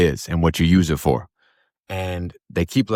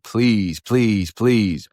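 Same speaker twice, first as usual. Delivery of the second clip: retold begging; 3 semitones higher, much slower than usual. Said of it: "he's begging them".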